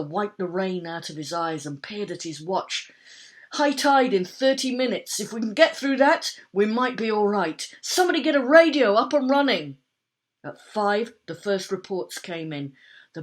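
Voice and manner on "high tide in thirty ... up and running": loud, high urgent orders; shouting over storm. A narrator's reading. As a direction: call out above the wind.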